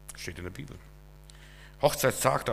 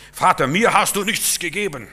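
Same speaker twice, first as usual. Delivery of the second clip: loud, higher complaint of a jealous son; whiny older brother; 6 semitones higher, 8 dB louder than usual. Acted. Older brother loudly complains to his father, resentful. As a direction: wail it like a wronged older brother. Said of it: "der hätte auch glücklich sein können".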